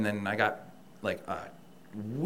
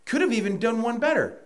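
higher, indignant, venting; heated rant; 12 semitones higher, 5 dB louder than usual. Real, not acted. Ranting to the host about the company's empty promise, heated and high. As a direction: let the frustration boil over.

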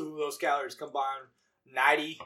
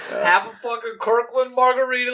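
cocky bro voice; 6 semitones higher, 8 dB louder than usual. Retold or imitated. Acted boastful gym reply.

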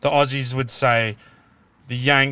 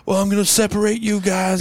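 higher-pitched mock American accent for a cheesy band; 6 semitones higher than usual. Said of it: "you notice I use the Yank accent".

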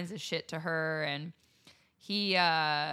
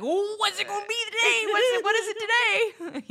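high, loud, gleeful nosy voice; eager nosy squeal; 7 dB louder than usual. Retold or imitated. On the balcony, eager for the neighbors' drama, high excited squeal. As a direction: squeal it like a thrilled busybody.

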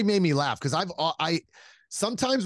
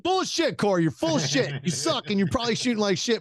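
high-pitched playful accusation; mock call-out; 6 semitones higher than usual. Teasing Corey for being so positive, mock call-out. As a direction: tease him in a raised, incredulous pitch.

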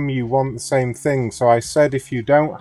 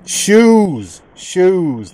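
shouting; 5 semitones higher, 6 dB louder than usual. Acted. Shouting at confused caller.

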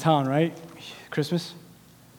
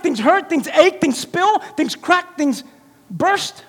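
loud, high, rhythmic preaching list; full-force preaching; 10 semitones higher, 7 dB louder than usual. Acted. Pastor rallying the congregation, loud and driving. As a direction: hammer each line at the church.

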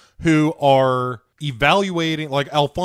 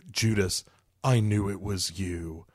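lower, softer betrayed murmur; low quiet voice; 6 semitones lower, 6 dB quieter than usual. Retold or imitated. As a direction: say it low and hushed. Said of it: "the lieutenant is like".

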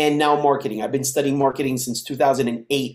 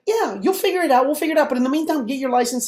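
high-pitched, brisk motherly reassurance; imitated reassuring mom; 10 semitones higher, faster than usual. Mom telling son to get licensed, reassuring. Mom brushes off his worry.

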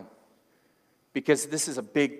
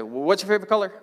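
loud, higher question; teasing phone question; 7 semitones higher, 6 dB louder, much faster than usual. Retold or imitated. Preacher jumping in before his stepdad replies, loud and teasing. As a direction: pipe up before he can answer.

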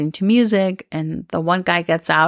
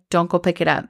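ordinary delivery

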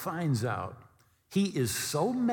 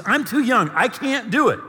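loud, high-pitched objection; protesting; 9 semitones higher, 9 dB louder than usual. Acted. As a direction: plead inability at raised volume. Young prophet protests his calling.

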